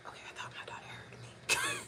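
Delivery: hushed whisper so the daughter won't hear; real whisper. Real, not acted. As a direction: whisper it, afraid of being overheard. Hiding from her daughter.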